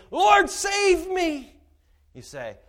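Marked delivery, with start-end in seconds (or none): 0.1-1.6 high, loud desperate shout; crying out. Acted. Sinking Peter crying to Jesus, a high desperate shout. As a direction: scream for rescue as you sink. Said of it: "he cried".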